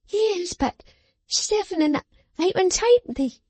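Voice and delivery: high, sharp scolding voice; scolding little woman. A narrator's reading. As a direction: scold him like a child. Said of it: "exclaimed Miss Wren".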